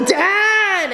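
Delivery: high, drawn-out mock wail; hammed-up daughterly embarrassment; mortified protest. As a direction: whine at your embarrassing dad.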